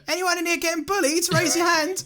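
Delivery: very high-pitched, hammed-up questioning; mock TV personality. Podcaster imitating a celebrity asking schoolkids, high mock voice. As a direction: imitate him calling out to the room.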